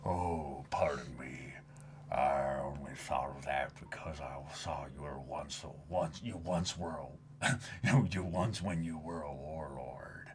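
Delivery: low, soft, half-whispered menace; quiet sinister character voice; hushed villain. Acted. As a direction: murmur it like a hidden threat. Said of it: "reading manga dialogue, hushed".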